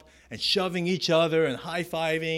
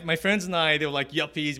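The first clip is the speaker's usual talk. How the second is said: ordinary talk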